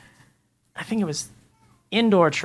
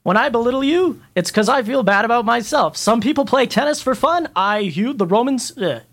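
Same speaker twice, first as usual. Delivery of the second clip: impression of Derek Knight; 5 semitones higher than usual. Mocking the show's host with a pumped-up radio voice. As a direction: play up the host's showy radio voice. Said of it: "I can do".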